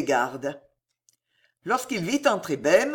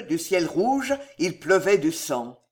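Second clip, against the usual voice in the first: usual speaking voice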